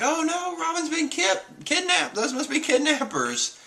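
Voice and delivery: very high, squeaky mock alarm; Chopper. Chopper seeing Robin captured, high mock-alarmed voice. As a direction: squeal it like a little character in a tizzy. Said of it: "Chopper's all like".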